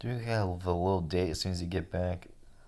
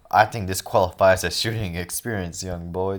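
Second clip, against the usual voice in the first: loud taunt; 8 dB louder than usual. Acted. Gunman taunting a boy.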